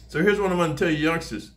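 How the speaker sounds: usual speaking voice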